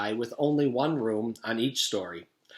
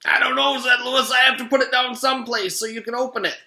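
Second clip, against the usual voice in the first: strained and urgent; 12 semitones higher, 7 dB louder than usual. Acted. Strained, high loud under weight.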